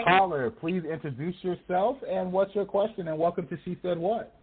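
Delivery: bright, high on-air welcome; radio host voice. Acted. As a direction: greet the caller like a radio host.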